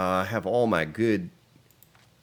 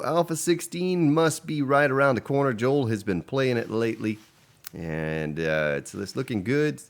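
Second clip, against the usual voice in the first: normal talking voice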